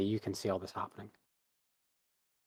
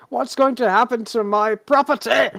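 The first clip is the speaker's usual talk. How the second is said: loud, much higher, wailing cry; shouting and wailing; 13 semitones higher, 10 dB louder than usual. Acted. Arrested man wailing about his property, loud high shout. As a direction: shriek it in panic over your belongings. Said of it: "he's just shouting and wailing".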